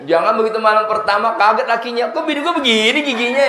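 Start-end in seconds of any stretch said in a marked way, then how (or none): 2.1-3.5 high, fast shocked cry; shocked husband. Retold or imitated. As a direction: blurt it like a stunned groom. Groom shocked on wedding night.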